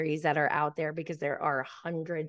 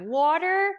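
high, loud imitated astonishment; mock disbelief; 10 semitones higher, 5 dB louder than usual. Man scoffing at water as her drink, mock disbelief. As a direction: blurt it high, as if water were absurd. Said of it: "making fun of me".

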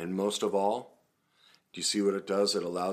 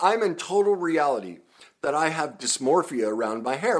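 usual speaking voice

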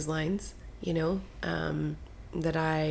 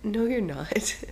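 lightly raised playful denial; playful contradiction; 5 semitones higher, slower than usual. Real, not acted. Host teasing co-host about fast talking, contradicting. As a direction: contradict them with a lift in pitch.

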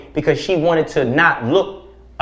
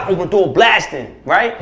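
usual speaking voice

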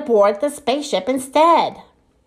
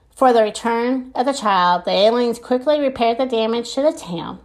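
ordinary delivery, nothing marked

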